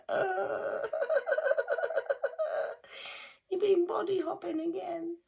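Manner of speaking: squeaky high taunting voice, laughing; mock the body hopper in a high squeal. Speaking to the body hopper, a squeaky high taunting voice.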